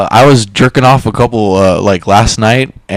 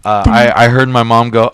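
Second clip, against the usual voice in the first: normal talking voice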